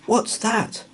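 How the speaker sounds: ordinary delivery, nothing marked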